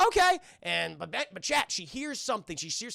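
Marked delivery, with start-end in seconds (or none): 0.1-0.4 high, loud little robot okay; loud high robot chirp. Retold or imitated. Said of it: "He goes".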